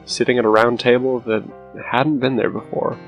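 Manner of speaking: plain reading voice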